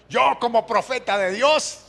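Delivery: high, loud, pompous prophet voice; mocking self-appointed prophet. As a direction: puff up like a self-proclaimed prophet. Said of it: "¿ha escuchado alguna vez esa?".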